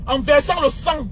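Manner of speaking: normal talking voice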